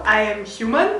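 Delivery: usual speaking voice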